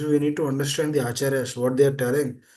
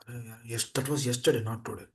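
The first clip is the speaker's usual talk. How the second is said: deflated; 5 semitones lower, 8 dB quieter than usual. Acted. Acting the deflated parent's reply.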